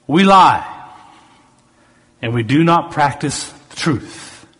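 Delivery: emphatic, lifted preaching read; scripture reading. Acted. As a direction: proclaim the verse, leaning into key words.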